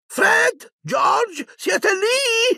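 very high, calling voice; high-pitched motherly holler. A narrator's reading. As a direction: call the children from the train door. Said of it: "giunse una voce dalla porta".

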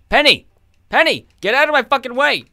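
high, loud shout to clear the way; mock-furious shout at a game villager; angry yell. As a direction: bellow at Penny to get out of the way. Yelling at Penny in the game.

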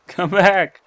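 loud, high call after someone. Real, not acted. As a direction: holler for them to return. Calls after vanished player.

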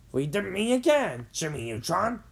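mildly truculent villain greeting. A narrator's reading. Professor facing his old enemy, mildly truculent. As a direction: raise the voice a touch, challenging. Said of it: "said the professor truculently".

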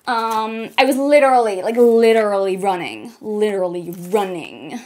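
mock American accent as Kendall Jenner, valley-girl vibe; terrible American accent. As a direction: do a hammed-up American model voice. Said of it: "my terrible American accent".

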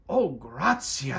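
a touch of eager gratitude; grateful. Acted. Big Anthony thanking the witch, mild eager thanks. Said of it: "said Big Anthony".

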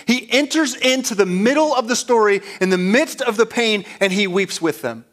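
high, loud sermon build; preaching at full force. Acted. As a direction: preach it out to the congregation, rising. Preaching climax.

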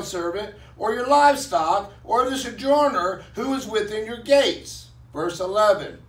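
measured, declaiming Bible reading; solemn pulpit reading; scripture reading. Acted. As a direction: read the commandments out to the congregation. Reads scripture aloud.

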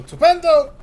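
high, loud gleeful cry of the word; triumphant estupendo. Real, not acted. Gamer luring enemies to a trap, high gleeful cry. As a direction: shout it in sudden delight.

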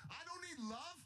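high-pitched mock scream, held back in volume; retold scream. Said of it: "And I'm screaming".

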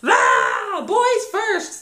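put-on high, loud toddler shriek; imitated child's outraged scream; tantrum yell. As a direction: yell it like a three-year-old throwing a fit. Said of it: "will just throw a fit".

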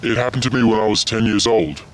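disguised, altered voice throughout; anonymous interview voice. Acted. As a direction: sound like a hidden-identity witness. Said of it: "this is how my voice sounded".

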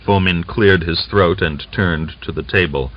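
usual speaking voice

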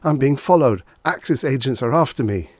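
frightened urgent whisper-shout; panicky. Acted. Panicked Reggie reports being followed.

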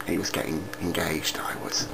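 secret whisper, very soft. Acted. Telling viewers a secret about Mister Ryder in a whisper.

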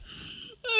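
wheezy squeaky laugh; hyena laugh. Real, not acted. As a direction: cackle like a dying hyena. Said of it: "I sound like a dying hyena".

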